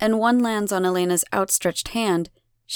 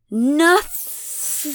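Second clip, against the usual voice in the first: wet, drawn-out, higher-pitched NOTHING; spat defiantly; 7 semitones higher, 3 dB louder than usual. A narrator's reading. Abigail defies the goddess, spitting NOTHING. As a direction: spit out NOTHING in two wet syllables. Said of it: "With as much moisture as she can muster between syllables, she spits".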